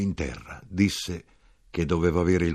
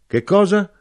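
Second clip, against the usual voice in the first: high, loud sharp question; loud demand; 10 dB louder than usual. A narrator's reading. Knife-grinder questioning the warning, loud and high. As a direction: shoot the question back loudly.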